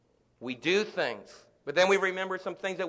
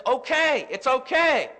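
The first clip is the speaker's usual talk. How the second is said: higher and louder, emphatic comfort; reassuring grace; 9 semitones higher, 7 dB louder than usual. Acted. Grace comforting those facing death, loud and high. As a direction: call out the reassurance boldly. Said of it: "but grace says".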